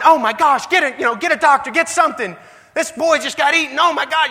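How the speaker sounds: high, loud, frantic cry for help; hammed-up alarm, pitched high and urgent; mock panicked yelling. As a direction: yell it like a freaked-out bystander. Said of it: "he's yelling".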